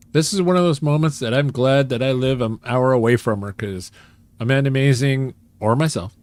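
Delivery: ordinary talk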